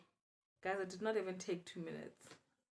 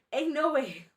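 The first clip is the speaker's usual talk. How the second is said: high, loud denial; loud disbelief; 8 semitones higher, 11 dB louder than usual. Real, not acted. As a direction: refuse it out loud, almost shouting. Denying the positive test.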